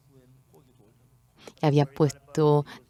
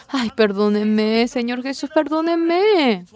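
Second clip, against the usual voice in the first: pleading; 8 semitones higher, 4 dB louder, slower than usual. Acted. Peter begs Jesus for forgiveness.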